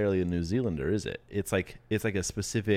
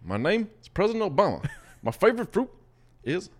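lightly voiced Obama, higher and playful; hammed-up presidential mango pitch; imitating Obama; 7 semitones higher, 3 dB louder than usual. Host imitates the president for a fruit game, mock impression. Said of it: "I should do, uh, President Obama".

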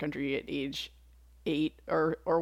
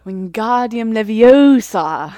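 slow, loud, prim Hermione spell; Hermione impression; 5 semitones higher, 12 dB louder, much slower than usual. Cohost asked for Hermione, slow loud Hermione spell. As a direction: sound out "Leviosa" like a know-it-all schoolgirl. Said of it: "my Hermione impression".

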